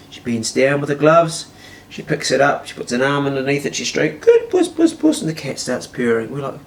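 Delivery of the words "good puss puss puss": very high falsetto coo; praising a cat. Retold or imitated. Girlfriend praises the rubbing cat, high cooing.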